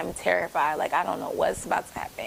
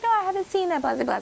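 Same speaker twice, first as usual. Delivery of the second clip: mock innocent; 11 semitones higher, 3 dB louder, faster than usual. Playing dumb to the barber.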